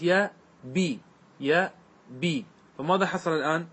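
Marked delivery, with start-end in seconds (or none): 0.0-2.4 يا بي as two slow parts, ya... bii; syllable by syllable. Shown as a demonstration. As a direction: sound out يا بي, long ii after a pause. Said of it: "فصارت". (تقطيع «يا بي» ببطء: يَـ، بِي)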